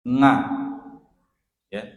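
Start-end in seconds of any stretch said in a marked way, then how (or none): 0.0-0.5 'nga' with an ng in place of ain; loud demonstrated 'nga', ng before the vowel; ain as nga. Shown as a demonstration. Teacher offering students an easier ain, said nga. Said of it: "Kalau sulit membacanya dengan a".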